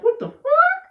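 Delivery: sudden high-pitched squeak of disbelief; shocked squeal. Retold or imitated. Shocked reaction to losing video game.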